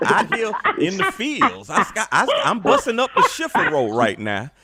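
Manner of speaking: hammed-up old plantation voice; mock enslaved field hand. Mock field worker to master.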